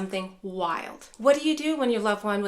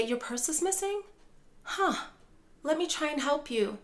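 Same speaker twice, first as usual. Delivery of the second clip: higher, softer, reassuring; gentle validating; 6 semitones higher, 4 dB quieter than usual. Shown as a demonstration. Caregiver soothing upset mom about purse, soft and higher. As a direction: meet her worry with gentle concern. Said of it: "You can say".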